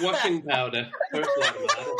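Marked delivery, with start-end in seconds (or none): 1.1-1.9 high, put-on Northern Irish voice; Northern Irish accent. Shown as a demonstration. Podcast host showing co-hosts his phrase for getting into accent, Northern Irish accent. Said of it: "for Northern Irish, it was always".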